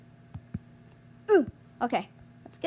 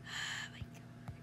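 breathless whispered oh my God; under the breath, barely voiced; 20 dB quieter than usual. Real, not acted. Frustrated gamer mutters to self.

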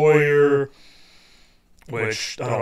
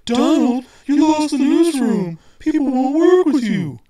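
much higher, louder, prim mock editor; 13 semitones higher, 6 dB louder than usual. Mimicking his editor telling him he lost the newsroom, high and loud. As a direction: ape the editor's scolding in a high voice. Editor voiced in retold meeting.

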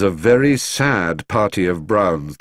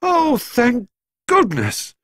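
relieved; slower than usual. A narrator's reading. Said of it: "wanted to laugh or cry".

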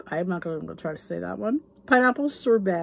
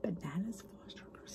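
very soft, savoring whisper; awed whisper; 3 semitones lower, 19 dB quieter than usual. Real, not acted. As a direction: whisper the dessert to yourself, relishing it. Repeats dish in awe.

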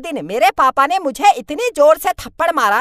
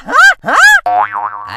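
very low, very slow, warped voice; distorted slow voice; 5 dB louder, much slower than usual. Acted. Uncle's yes turned into a slowed-down warped voice. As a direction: drag it out like a slowed tape.

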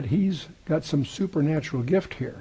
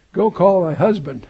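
raised scripture line; 4 semitones higher, 6 dB louder than usual. Acted. Repeated verse, raised.

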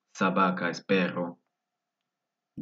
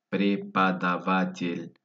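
slow, drawn-out model pronunciation; slowed-down word; slower than usual. Shown as a demonstration. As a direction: stretch the word out for learners. Word repeated slowly by syllables.

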